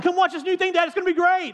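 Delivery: high, bouncy imitation of a little girl; eager daughter. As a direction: squeal it like a kid showing off a new trick.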